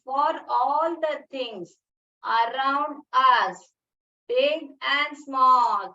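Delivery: slow, each word drawn out; slow recitation. Shown as a demonstration. Teacher modelling the poem line for young pupils, slow. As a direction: spell the line out slowly for children.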